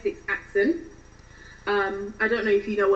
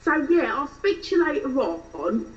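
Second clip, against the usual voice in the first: Essex accent. Shown as a demonstration. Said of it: "doing a typical British Essex accent".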